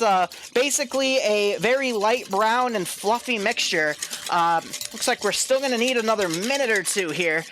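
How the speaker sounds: usual speaking voice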